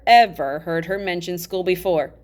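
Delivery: ordinary delivery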